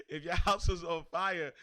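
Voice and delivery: amused, voice lifted by a laugh. Real, not acted. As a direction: say it while cracking up. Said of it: "I'm, I'm laughing because".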